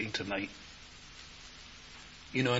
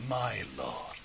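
much lower and softer, awed; reverent whisper; 11 semitones lower, 7 dB quieter than usual. Acted. Thomas confessing to the risen Lord, hushed and reverent. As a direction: breathe it out in awe.

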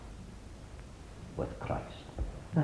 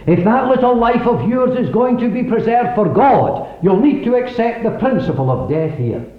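raised, high preaching voice; fervent preaching; 8 semitones higher, 4 dB louder than usual. Real, not acted. Preaching at full force.

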